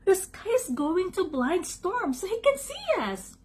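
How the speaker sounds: high, excited dog voice; excited high-pitched announcement; announced. A narrator's reading. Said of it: "Doug announced".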